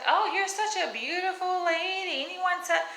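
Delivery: high mock sweet lady voice. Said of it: "she was like".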